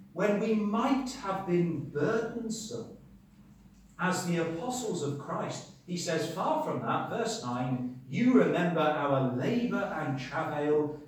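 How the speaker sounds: preacher declaiming scripture, drawn out and forceful; emphatic scripture reading. Acted. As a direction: read the verse out loud and weighty, leaning on key words.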